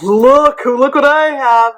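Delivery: high, loud mock boast; hammed-up proud show-off; excited Andrew. Andrew showing Amira the mug, mock excited high voice. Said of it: "and he's like".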